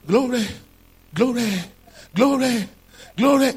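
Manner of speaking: high, loud showy chant of praise; mock religious. Preacher imitating believers giving glory to God, mock religious. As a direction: repeat it like a rote religious cry. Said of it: "You can even say religiously".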